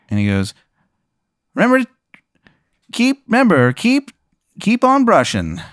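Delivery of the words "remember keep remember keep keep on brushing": very high-pitched cartoon character voice; high put-on mascot voice; Tim the Tooth. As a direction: squeak out a sign-off in falsetto. Tim the Tooth gives parting slogan.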